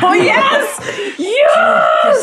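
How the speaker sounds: loud, high delighted cheer; excited shout. Real, not acted. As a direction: yell with joy. Cheering a good accent.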